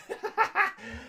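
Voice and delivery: high-pitched chuckle; amused laugh. Real, not acted. Recalling having no GPS in Greece, amused laugh. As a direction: laugh at your own predicament.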